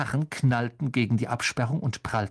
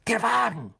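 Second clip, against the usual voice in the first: higher, eager exclamation; boy announcing his plan, much higher; excited; 9 semitones higher than usual. A narrator's reading. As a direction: blurt out the brilliant idea. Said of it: "sagte Ron mit leuchtenden Augen".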